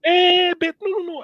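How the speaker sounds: sharp high burst of protest. Real, not acted. Interrupting the co-host, high excited protest. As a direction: cut him off high.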